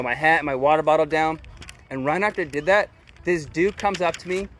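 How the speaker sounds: ordinary delivery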